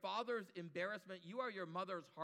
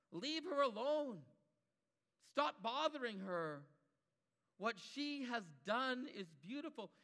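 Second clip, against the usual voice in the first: slow, high and louder; slow each phrase down, shielding her; Jesus firmly defending the woman from the disciples; firm defence; 5 semitones higher, 4 dB louder, slower than usual. Acted.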